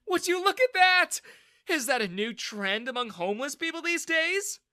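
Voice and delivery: high, loud mocking jeer; Daniel sneering. A narrator's reading. Bully mocking a soaked rival in front of friends, sneering. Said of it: "Daniel sneered".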